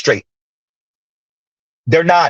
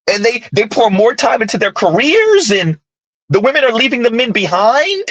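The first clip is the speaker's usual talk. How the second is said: higher-pitched uptalk parody, statements ending as questions; mock uptalk; 4 semitones higher than usual. Host parroting commentators on women leaving men behind, mock uptalk. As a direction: mimic their questioning lilt, mockingly. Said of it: "Because that's how they talk".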